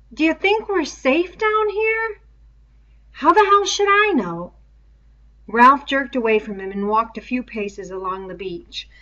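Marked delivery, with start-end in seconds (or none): 0.1-4.5 put-on high, frightened boy asking; a boy's panic in his voice; high voiced boy afraid; scared boy voice. Boys voiced in story.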